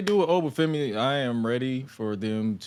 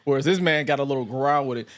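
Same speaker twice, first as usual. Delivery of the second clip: ordinary talk, nothing marked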